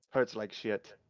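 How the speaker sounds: usual speaking voice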